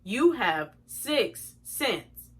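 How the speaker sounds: very slow automated-line readout; customer service recording. Retold or imitated. Imitating the gift card line reading her balance, slow recorded voice. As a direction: space out each word like a phone balance message. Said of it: "These people say".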